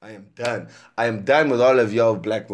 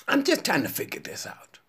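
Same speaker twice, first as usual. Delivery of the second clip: whispered aside; 5 semitones higher, 6 dB quieter than usual. Real, not acted. Muttered aside mid-rant.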